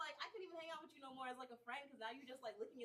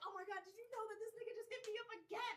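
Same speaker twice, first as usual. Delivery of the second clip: higher-pitched excited gossip; mock-scandalized phone call, pitched up; acted gossipy call; 10 semitones higher than usual. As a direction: spill it to a friend, high and eager. Acts out excited late-night call.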